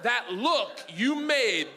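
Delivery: ordinary talk, nothing marked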